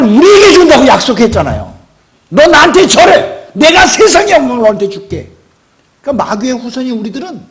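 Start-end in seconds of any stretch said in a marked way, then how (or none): 2.3-5.3 devil's temptation. Acted. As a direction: lure them to bow, high and loud. Devil voiced tempting with glory.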